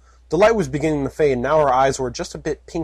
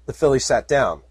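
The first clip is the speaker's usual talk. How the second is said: ordinary talk, nothing marked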